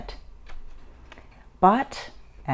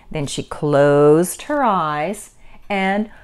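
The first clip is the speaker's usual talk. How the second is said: usual speaking voice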